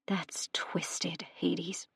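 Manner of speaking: breathless shocked whisper. Acted. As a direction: barely voice it, stunned. Shocked reply after his shout.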